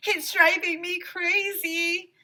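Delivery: high, loud, slow desperate yell; Emily yelling high and loud over the beeping; shouting. A narrator's reading. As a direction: yell it like a kid at her limit. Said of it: "shouted Emily".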